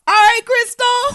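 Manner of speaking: loud, high cheer; excited shout. Real, not acted. Nicknaming the boy Christoph, a loud high cheer.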